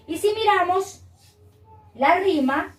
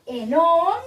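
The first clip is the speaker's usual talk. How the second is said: "on" held long, higher; raised, drawn-out "on"; stressed rhyme ending; 4 semitones higher, 4 dB louder than usual. Shown as a demonstration. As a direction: point out the ending "on" by stretching it. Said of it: "¿Dónde está la rima?".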